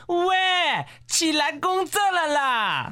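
high, drawn-out mock call; imitated shout. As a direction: imitate a visitor calling to wake someone. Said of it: "还喊道".

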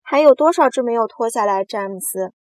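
loud, insistent call; shouted question. A narrator's reading. Centipede asking James how many boots remain, shouted. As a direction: call out, very big at first. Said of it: "蜈蚣叫道".

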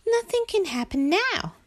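high, contented little voice; relieved Frog. Acted. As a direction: think it aloud, high and safe. Frog reassured in bed.

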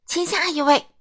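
loud, high roar. A narrator's reading. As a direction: roar at the customer. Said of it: "牛奶店老板咆哮着说". (高声咆哮)

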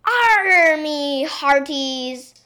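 loud, long drawn-out pirate growl and cry; pirate roar. A narrator's reading. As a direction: roar it slowly like a play pirate. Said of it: "roared the little princess".

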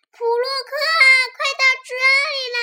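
high, loud summons; shouted call. Acted. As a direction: holler across the cinema. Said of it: "她站在过道处喊道".

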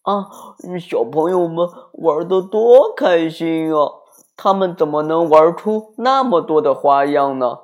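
high, loud wonder; amazed. A narrator's reading. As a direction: marvel at the children at play. Troll marvels watching children.

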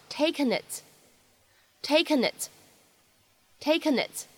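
"taken it" very slow, n linked into "it"; linking. Shown as a demonstration. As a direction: join "taken it" as one word, slowly. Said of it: "咱们可以自然的连读一下". (taken it连读，慢速示范)

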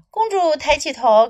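ordinary delivery

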